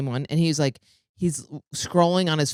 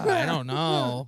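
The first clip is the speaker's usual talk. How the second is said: slow, higher, dazed I don't know; dopey mock friend; 8 semitones higher, much slower than usual. Friend on the jail phone call, slow and dopey. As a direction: drag it out like a clueless friend.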